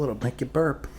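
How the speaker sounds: ordinary delivery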